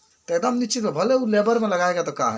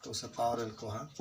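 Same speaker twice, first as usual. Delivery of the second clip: dictation; 3 semitones lower, 6 dB quieter than usual. Acted. Dictating notes slowly.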